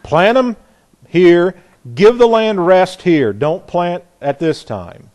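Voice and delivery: a firm parent laying down the law; loud commanding order. Retold or imitated. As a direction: bark the orders at the people. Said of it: "He said".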